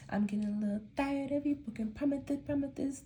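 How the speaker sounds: lisping on promises when tired; sleepy slurred lisp on promises. Real, not acted. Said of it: "I develop a lisp".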